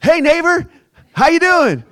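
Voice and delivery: loud, high cheery neighbor greeting; neighbor popping out. Retold or imitated. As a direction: jump out with a bright shouted hello. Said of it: "like, he goes".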